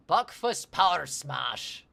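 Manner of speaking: loud, slow, hammed-up Scottish accent; awful Scottish accent. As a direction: belt the song title in a bad Scottish accent. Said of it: "That was an awful Scottish accent for me".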